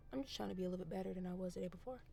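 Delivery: soft, rushed run of words; very fast. Real, not acted. Filmmaker just trying to be a little better, said fast. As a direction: race through it under your breath. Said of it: "I said that so fast".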